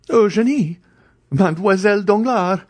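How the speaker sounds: high, loud astonished cry; stupefied exclamation. A narrator's reading. Andrea recognizing Eugénie, crying out in shock. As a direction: blurt out the names in shock. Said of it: "exclaimed Andrea, stupefied".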